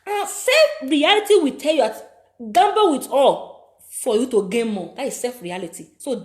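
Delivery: loud, forceful sermon voice; impassioned preaching. Acted. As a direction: preach it hard to the gamblers.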